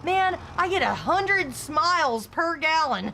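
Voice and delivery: high, loud, slow punchline; mock proud owner. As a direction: savor the joke like a smug owner. Imitating BMW owner's joke.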